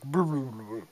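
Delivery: rolled, burbling "brr" water sound; vocal waterfall effect; waterfall noise. Retold or imitated. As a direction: imitate rushing water with a trilled brr. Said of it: "the Waterfall's like".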